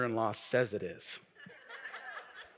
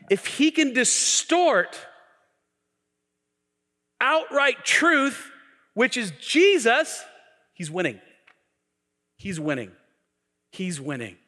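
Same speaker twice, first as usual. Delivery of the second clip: much higher, louder, slow and driving; full-force preaching; 10 semitones higher, 7 dB louder than usual. Acted. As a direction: preach it hard to the crowd.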